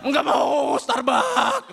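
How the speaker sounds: loud high whiny shout; bratty possessed refusal. Acted. Possessed man refusing coffee, high bratty shout. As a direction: throw a tantrum like a spoiled spirit.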